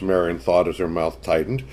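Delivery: ordinary delivery, nothing marked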